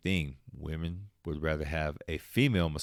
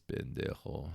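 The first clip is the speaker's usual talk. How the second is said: muttered insult; 6 semitones lower, 10 dB quieter, slower than usual. Retold or imitated. Muttered insult under breath.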